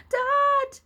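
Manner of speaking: very high, very loud scream of Dad; a boy's anguished scream. A narrator's reading. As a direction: scream for your father in horror. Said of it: "William screamed".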